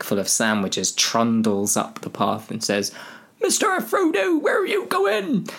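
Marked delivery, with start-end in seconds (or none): 3.4-5.5 very high falsetto call; Sam. Acted. Sam calls after Frodo, squeaky high voice. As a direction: squeal it after a departing friend.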